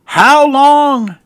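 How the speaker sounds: loud, high, drawn-out plea; impassioned cry of the psalmist. Retold or imitated. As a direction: wail it upward as a desperate question. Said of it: "he cries out".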